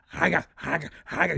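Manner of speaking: greedy chomping imitation; mock gobbling noises. Host mimics a vegetarian gobbling bacon, mock chomping. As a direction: imitate someone wolfing food down.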